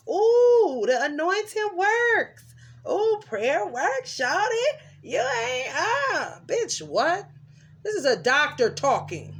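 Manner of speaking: high, loud mocking voice, slow on the praise lines; mocking Chelsea. Mocking Chelsea's praise and taunts, high and loud. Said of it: "You know what she said?".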